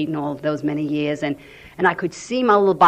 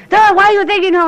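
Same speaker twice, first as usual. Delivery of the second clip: much higher, louder mocking yell of "Duh"; angry retold scream; 9 dB louder than usual. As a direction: throw it back at him, furious. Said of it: "I was really screaming at him".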